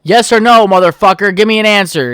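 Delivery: loud, high furious demand; retold angry confrontation, loud. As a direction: bark it at the clerk. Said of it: "I was just so pi".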